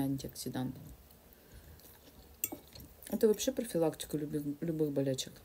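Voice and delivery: talking while chewing; speaking between bites of food; mouth full of salad. Real, not acted. Seen 1: Forkful of greens enters mouth at 1.7–2.0, then chewing, another forkful.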